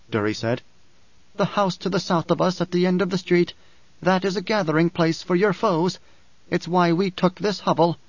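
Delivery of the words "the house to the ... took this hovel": higher character voice, measured and firm; Dory, firm woman. Acted. Dory urges Paul to take the dwarf, firmly. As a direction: lift the voice for a resolute woman.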